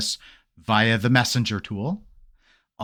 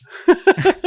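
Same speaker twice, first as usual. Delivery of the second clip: high burst of laughter; 7 dB louder than usual. Real, not acted. Kid story punchline.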